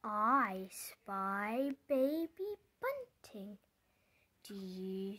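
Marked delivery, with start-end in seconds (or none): none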